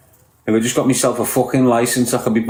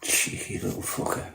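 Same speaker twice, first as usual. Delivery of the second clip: muttered under the breath, voiceless; whispered aside; whispered insult; 14 dB quieter than usual. Real, not acted. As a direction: hiss it to yourself so viewers barely catch it.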